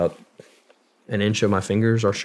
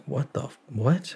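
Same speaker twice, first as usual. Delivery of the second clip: retold bewilderment; 6 semitones higher than usual. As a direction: act out being stunned, voice lifting. Retold stunned reaction to agent.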